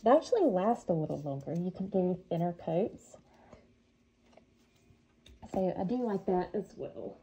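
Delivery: speaking through a respirator mask; muffled, wheezy voice behind a mask. Real, not acted. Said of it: "excuse me if it sounds like I'm wheezing".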